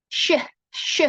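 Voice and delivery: "sh" as an unvoiced hushing shh. Shown as a demonstration. Teacher showing students the sh sound, hushed shh. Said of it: "This is pronounced".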